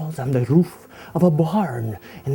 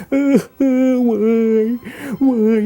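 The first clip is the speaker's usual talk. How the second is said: high, loud mock wail; mock crying; 7 semitones higher, 5 dB louder than usual. Old man in the park wailing alone, mock crying. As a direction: ham up a baby's blubbering. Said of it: "he's crying like a little baby".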